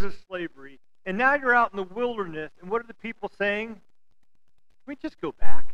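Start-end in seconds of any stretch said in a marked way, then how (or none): 3.7-5.7 whiny plea. Retold or imitated. People whining in the wilderness.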